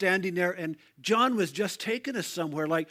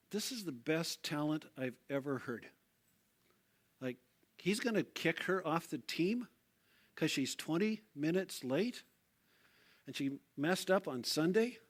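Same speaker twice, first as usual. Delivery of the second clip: much softer, halting inner voice; hushed disbelief; 2 semitones lower, 8 dB quieter than usual. Retold or imitated. Watching from the back as she is cut, hushed disbelief. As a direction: question it under your breath, incredulous.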